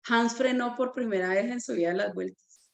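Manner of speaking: ordinary delivery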